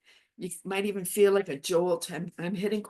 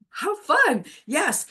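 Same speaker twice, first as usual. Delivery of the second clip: excited; 9 semitones higher, 5 dB louder, much slower than usual. Acted.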